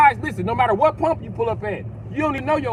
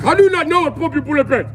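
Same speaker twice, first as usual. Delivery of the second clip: higher, louder mock African accent, complaining; 7 semitones higher, 4 dB louder than usual. African cashier scolding a customer, mock African accent. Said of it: "The African guy be like".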